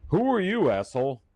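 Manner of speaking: loud, high confrontational bark; aggressive challenge. Real, not acted. Streamer confronts the caller who mocked him, loud and hostile. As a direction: get in his face.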